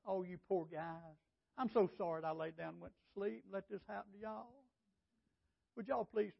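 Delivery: a hushed, slowed-down, mock-sorry voice, not a real one; mock pity. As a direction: coo it like a pushover apologizing. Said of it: "He didn't turn around and say".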